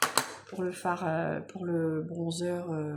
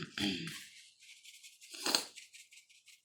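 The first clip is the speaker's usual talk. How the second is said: sniffling; 9 dB quieter than usual. Real, not acted. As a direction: sniffly voice from a cold. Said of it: "je renifle encore".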